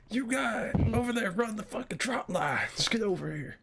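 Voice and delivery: high, loud hammed-up cop; mock cop yell. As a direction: bellow like a cop with a spotlight. Friend mimics the shouting cop.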